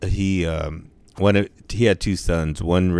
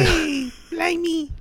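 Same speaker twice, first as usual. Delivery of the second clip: much higher, slow squeaky cartoon English accent; mock English accent; much slower than usual. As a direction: screech it like a cockney caricature.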